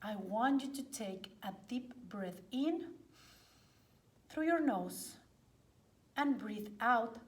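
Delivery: hushed yoga teacher guiding a body scan; guided meditation. Acted. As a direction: ease them gently into relaxation, phrase by phrase.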